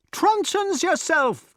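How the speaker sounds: very high, slow heckle; high-pitched jeering comeback; defiant retort. A narrator's reading. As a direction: throw it back mockingly high. Prisoners answer back defiantly.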